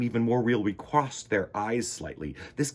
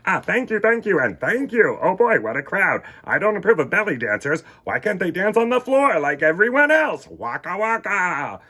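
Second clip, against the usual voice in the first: higher, louder joke-telling; comic bear routine; 7 semitones higher, 7 dB louder than usual. Acted. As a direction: ham up the corny stand-up. Fozzie stand-up routine.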